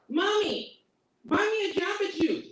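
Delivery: put-on high whiny child voice; whiny kid. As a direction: whine for the juice like a little boy. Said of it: "a little kid do some shit like".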